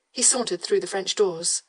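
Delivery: ordinary delivery, nothing marked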